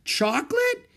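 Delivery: very high squeaky chocolate?; the two assistants echo it, very high; echoed squeakily. A narrator's reading. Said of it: "echoed Doctor Fargo's two assistants".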